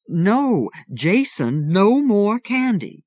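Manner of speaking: high, loud, slow scolding; scolding mom. A narrator's reading. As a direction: call a child off the candy.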